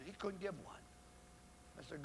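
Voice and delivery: ordinary delivery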